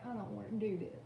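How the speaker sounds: soft mumbled voicing of a sulky dog; dog owner voicing her pet turning away, soft and sulky. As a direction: give the dog's unwilling thought. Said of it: "like, looking away like".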